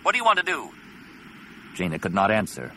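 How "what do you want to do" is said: fast, high urgent question. Acted. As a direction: rush the question under fire.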